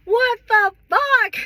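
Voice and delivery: high, drawn-out outrage; outraged disbelief. Real, not acted. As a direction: stretch it out, incredulous. Outraged at polygamy show.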